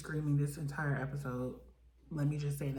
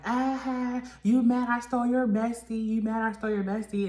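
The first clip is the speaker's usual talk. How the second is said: taunting; 6 semitones higher, 4 dB louder than usual. Retold or imitated. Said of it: "she's just like".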